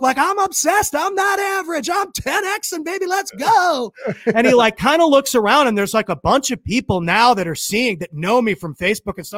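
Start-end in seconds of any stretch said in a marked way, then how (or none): 0.2-3.9 high-pitched motivational slogans; hyped-up reciting. Retold or imitated. Fan reciting Cardone's book at him, hyped-up. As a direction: chant the slogans like a superfan. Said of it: "reciting lines from all his book".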